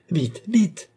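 urgent, high and loud; cried out. A narrator's reading. Old man calling his servants, urgent and loud. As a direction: call the servants in a panic. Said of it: "cria-t-il".

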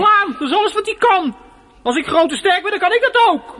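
loud, high defiant outburst; boy yelling at his mother, loud and high; bitter shout. Acted. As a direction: hurl it back at her.

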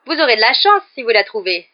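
a cheeky little ghost jeering; squeaky little voice. A narrator's reading. Peeves taunting the teachers, lightly voiced squeaky jeer. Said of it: "lança une petite voix criarde".

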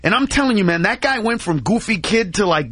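usual speaking voice

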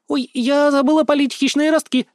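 put-on high-pitched girlish exclamation; alarmed cry. A narrator's reading. Girl making her escape from father, alarmed cry. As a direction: cry out high with sudden remembering. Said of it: "вскрикнула Тиана и исчезла".

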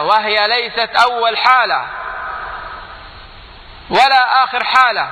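slow, rising emphasis on each word; building sermon cry; building preaching. Real, not acted.

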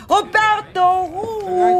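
high, loud, slow sing-song call. Acted. Aunt calls Roberto across the casino, high drawn-out hail. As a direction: stretch the name out across the room.

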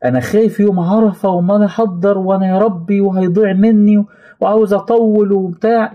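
high, fretful voice of a worried believer; anxious worrier. Retold or imitated. Preacher mimics an anxious listener in a high fretful voice. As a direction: whine the worry in a high anxious pitch. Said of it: "اوعى تكون فاكر".

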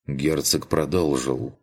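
ordinary delivery

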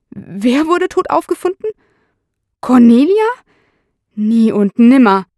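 high, rising questions turning to loud denial; acted shock, voice jumping up then insisting; shocked disbelief. A narrator's reading. Suspect learns fiancée is dead. (entsetzt und ungläubig)

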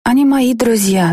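put-on high, childlike reply; lightly voiced young daughter; little girl voice. A narrator's reading. Narrator voices six-year-old girl.

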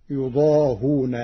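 يضاهون with the hamza dropped, ha carrying damma; Warsh reading. Shown as a demonstration. Teacher demonstrating the Warsh form, يضاهون without hamza. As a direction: say يضاهون as yuḍāhūna, no glottal stop. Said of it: "فتصير".

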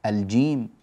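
ordinary talk

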